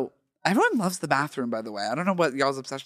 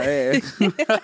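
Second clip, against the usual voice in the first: ordinary talk, nothing marked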